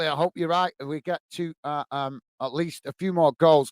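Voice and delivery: ordinary delivery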